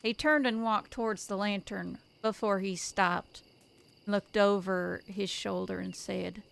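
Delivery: calm, measured storytelling read; story narration. A narrator's reading.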